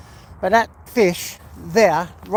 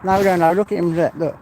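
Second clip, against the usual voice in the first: normal talking voice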